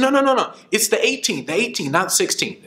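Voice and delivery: high, quick caller rushing to correct the date; eager correction. Retold or imitated. Organizer hurries to correct the speaker's date, high and eager. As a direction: jump in to fix the mix-up.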